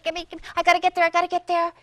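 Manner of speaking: fast, loud, urgent rush; hurry, hurry, can't get there fast enough; a king racing his chariot to the den; frantic hurry. Acted.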